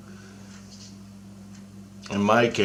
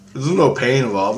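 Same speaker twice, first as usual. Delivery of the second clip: ordinary talk, nothing marked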